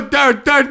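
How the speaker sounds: loud high vocal air horn; air horn noise. Shown as a demonstration. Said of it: "We just go".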